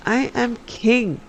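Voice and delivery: put-on high, slow petulant child. A narrator's reading. Uriel insisting to his mother, petulant. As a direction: drag it out like a sulky boy. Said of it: "said Uriel petulantly".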